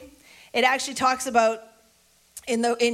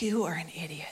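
self-disgust; 6 semitones lower, 8 dB quieter than usual. Acted. Scolding oneself in the mirror.

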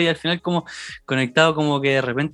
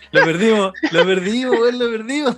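raised pitch, louder, half-laughing; mock-alarmed, climbing higher as it repeats; retold alarm; 8 semitones higher, 3 dB louder than usual. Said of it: "Y decíamos".

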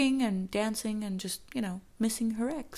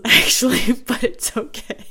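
loud, higher, talking through laughter; laughing; 4 semitones higher, 13 dB louder than usual. Real, not acted. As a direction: crack up while excusing yourself. Laughs at own accent.

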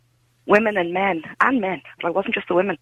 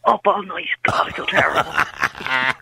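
slow, feeble put-on sick voice; 3 semitones lower, slower than usual. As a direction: drag it out like a fake sick call. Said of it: "Putting on the sick voice".

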